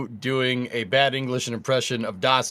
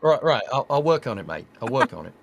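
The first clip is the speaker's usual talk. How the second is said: British accent attempt. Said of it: "Hey, that's quite good".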